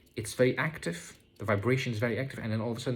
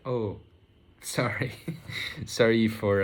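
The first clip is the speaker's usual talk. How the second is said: retold apology broken by small laughs; laughing apology; 4 dB louder than usual. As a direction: laugh through a re-enacted sorry. Retells her sheepish apology with laughs.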